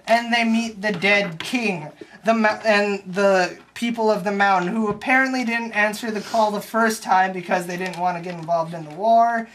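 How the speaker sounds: ordinary delivery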